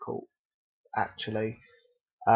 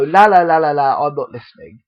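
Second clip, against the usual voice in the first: mocking la la la; 9 dB louder than usual. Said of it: "they go".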